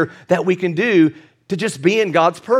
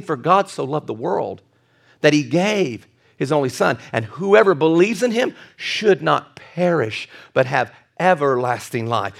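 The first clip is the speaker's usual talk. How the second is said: scripture recitation; 6 semitones lower than usual. Acted. Quotes John three sixteen.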